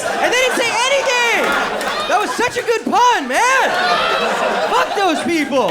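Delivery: mock outrage. The table ignored his soup pun, high indignant squeal. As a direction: protest how good the pun was.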